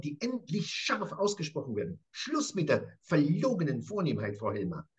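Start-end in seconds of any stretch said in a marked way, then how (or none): none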